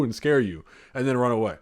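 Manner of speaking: usual speaking voice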